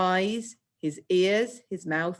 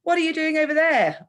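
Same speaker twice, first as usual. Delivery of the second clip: much higher and louder demanding question; calling out a question; 10 semitones higher, 6 dB louder than usual. Acted. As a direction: call it across the room, wanting an answer. Teacher voices Mrs Bevins asking.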